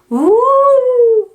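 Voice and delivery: long loud high howl; wind howl. Acted. Reading to a child, a loud wind howl.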